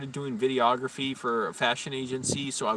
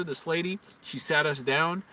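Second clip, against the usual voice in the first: normal talking voice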